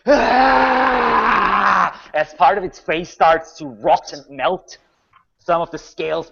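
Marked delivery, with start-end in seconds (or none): 0.1-2.0 long, high dragon shriek; dragon's cry. Acted. Game master voices the dragon hit by necrotic damage, shrieking. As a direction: let out a drawn-out monster scream. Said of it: "So the dragon is like".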